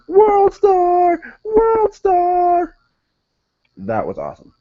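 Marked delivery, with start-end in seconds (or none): 0.1-2.8 very high, drawn-out mock scream; imitation falsetto yell, each word held long; imitated screaming. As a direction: holler it like a hyped-up bystander in a video. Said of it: "just screaming".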